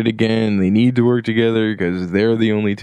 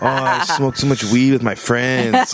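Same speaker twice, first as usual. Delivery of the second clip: stoner; 3 semitones higher than usual. Acted. Acting stoned after X-Files news.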